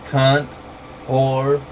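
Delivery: ordinary talk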